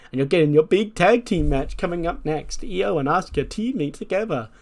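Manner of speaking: high, sing-song mock enthusiasm. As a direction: taunt them about their big match. Acts out Candice mocking rivals.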